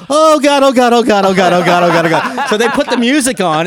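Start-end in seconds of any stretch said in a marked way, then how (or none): 0.1-2.5 high, loud mock panic; panicked stage fright. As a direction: freeze on stage and repeat in panic. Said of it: "and then he went".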